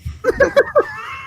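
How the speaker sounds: a sudden squeaky laugh; real laugh breaking out mid-story; high burst of laughter. Real, not acted.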